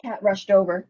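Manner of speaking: ordinary delivery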